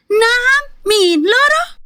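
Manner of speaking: high, stunned disbelief on each word; the mother can't believe it's Lara, voice leaps up; shocked gasp. Acted. Stunned high-pitched disbelief.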